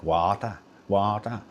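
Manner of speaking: Welsh water with a rising lilt; Welsh accent. Retold or imitated. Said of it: "crack up when British people say water".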